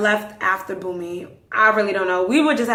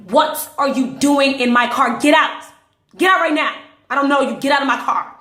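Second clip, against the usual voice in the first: loud, raised, emphatic commands; forceful ordering; 4 semitones higher, 5 dB louder than usual. Retold or imitated. She orders a stranger out of the car, loud and forceful. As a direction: order the intruder out, punching each phrase. Acted confrontation with the intruder.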